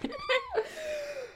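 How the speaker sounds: high shrieking burst of laughter; a high squeal of laughter at the memory. Real, not acted. Laughing at the fry story.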